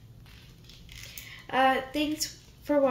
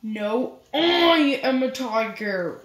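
slow, louder insistence; insistent mouse; 4 dB louder, slower than usual. Acted. Mouse insisting to the animals, slow and louder. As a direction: plant your feet and insist.

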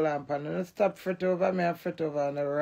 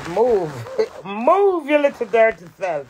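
playful shout; 3 semitones higher, 6 dB louder than usual. Pretend. Shooing someone off, laughing.

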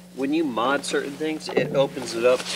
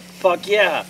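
eager yes, pitched up and loud; excited; 6 semitones higher, 5 dB louder than usual. Retold or imitated. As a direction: jump at the offer. Eager reply to game invite.

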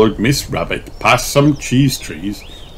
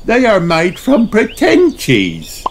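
high, slow cheerful hostess; Miss Rabbit voice; 13 semitones higher than usual. Acted. Miss Rabbit welcomes the party, cheerful guide voice. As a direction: greet them like a bubbly guide.

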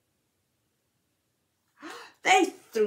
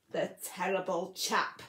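plain reading voice

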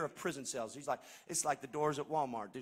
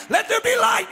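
higher, louder proclamation; voice of God; 14 dB louder than usual. Retold or imitated. Pastor voicing God at creation, a raised proclamation. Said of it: "he started it by saying".

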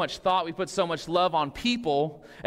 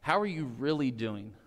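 lower, softer, asked gently and earnestly; sincere check-in; 4 semitones lower, 5 dB quieter than usual. Retold or imitated. Pastor as close friend asking softly and sincerely. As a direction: lean in and really ask. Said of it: "you look at your friend and you go".

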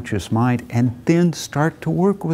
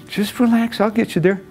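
higher, easygoing reassurance; breezy friend; 8 semitones higher than usual. Retold or imitated. Walter brushing off Arthur's question while driving, breezy. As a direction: wave the worry off lightly.

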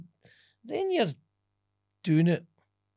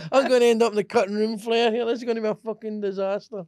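hammed-up dread, higher and louder; groaning that he is doomed to the cutting room, half-laughing; mock panic; 6 semitones higher, 6 dB louder than usual. Fearing he would be cut from the film, mock panicked. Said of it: "the anxiety".

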